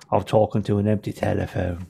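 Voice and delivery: put-on British gangster accent; British limey accent. Bob Hoskins as a British thief, imitated British accent. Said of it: "You want him like a limey".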